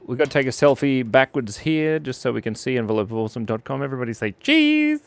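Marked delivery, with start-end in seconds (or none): 3.8-5.0 excited. Real, not acted. Selfie countdown call.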